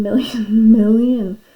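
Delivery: loud, laughing on "million"; tickled by an absurdly big number; amused repetition. Real, not acted. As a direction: laugh through the piled-up millions.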